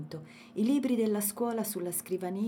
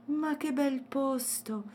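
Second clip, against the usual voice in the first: slow, high wonder; exclaiming; 8 semitones higher, slower than usual. A narrator's reading. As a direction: marvel at the view. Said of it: "esclamò Matilde". (esclamazione meravigliata, lenta)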